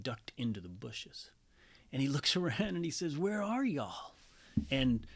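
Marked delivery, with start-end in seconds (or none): 3.2-4.1 Texan drawl. Retold or imitated. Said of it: "God is a Texan".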